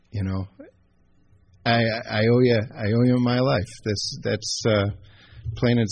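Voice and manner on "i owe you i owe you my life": usual speaking voice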